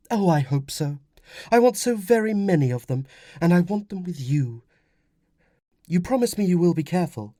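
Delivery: high woman's voice, heartfelt; earnest wife. Acted. Wife asks husband for promise, earnest.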